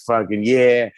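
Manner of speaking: put-on lightly done Boston accent; a hint of thick Boston accent; Ben Affleck Boston accent. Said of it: "thick-ass Boston accent".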